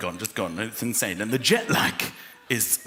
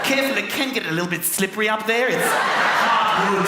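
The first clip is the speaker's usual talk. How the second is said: put-on New Zealand accent; 6 semitones higher, 4 dB louder than usual. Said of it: "she said".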